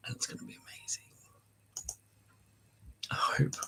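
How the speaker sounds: excited whisper. Real, not acted. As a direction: hush it to the viewers. Hushed aside to viewers.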